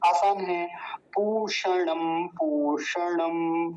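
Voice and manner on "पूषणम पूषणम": पूषणम with a long ऊ and retroflex ष; very slow teaching demo; slow careful pronunciation. Shown as a demonstration. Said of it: "मूर्धन्य शकार का और ये दीर्घ ऊकार".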